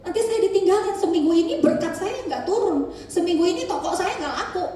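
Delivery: a hint of laughter at the end as he mocks the fretful believer; mock fearful believer. Mocking a fearful believer's thinking.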